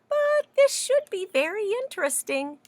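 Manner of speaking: high, intrigued cat; lightly voiced curious cat. Cat's thought voiced.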